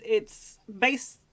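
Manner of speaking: ordinary delivery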